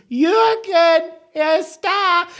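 put-on very high, fluttering old-lady voice; shrill granny calling for Jürgen; hammed-up old woman calling her husband.

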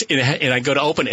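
ordinary delivery

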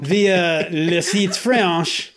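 very slow mock French accent. Shown as a demonstration. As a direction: drag it out in a hammed-up French accent. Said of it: "Shouldn't you be reading this in a French accent?".

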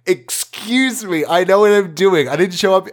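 high, huffy objection to the client; indignant protest. Retold or imitated. Defending himself to the client, huffy and high. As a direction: act offended, pitch up.

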